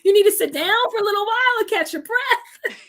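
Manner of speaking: high, slow, over-concerned fretting with a laugh; fussy worried friend, laughing; mock worried friend. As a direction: ham up a panicking friend fussing over her. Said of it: "he was, like, devastated".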